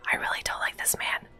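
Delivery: low-volume whispered dislike; whispered aside. Real, not acted. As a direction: whisper it like a secret grumble. Private aside about disliked character.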